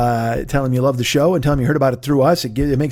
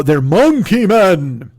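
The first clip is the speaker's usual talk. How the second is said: monkey men; much slower than usual. Retold or imitated. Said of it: "they go".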